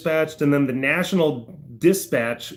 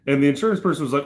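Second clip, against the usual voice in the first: ordinary talk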